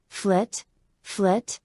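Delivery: ordinary delivery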